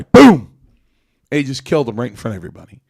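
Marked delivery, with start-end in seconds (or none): 0.0-0.5 loud, high-pitched "boom" gunshot sound; sudden mouth gunshot noise; mock gunshot. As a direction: blurt the gunshot out with a bang. Gunshot in retold execution.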